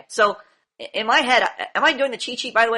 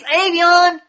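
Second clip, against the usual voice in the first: higher, pressing call of his name; demanding; 7 semitones higher, 4 dB louder than usual. Retold or imitated. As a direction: demand it with a scrunched face. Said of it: "I'm demanding of him".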